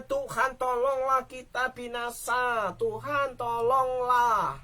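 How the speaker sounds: drawn-out pleading cry; desperate plea. Acted. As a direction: beg for rescue as the boat sinks. Disciples cry for help.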